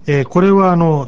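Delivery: ordinary talk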